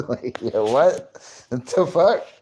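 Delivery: high, loud disbelief; what the hell, laughing; incredulous. Real, not acted. Laughing disbelief at own words.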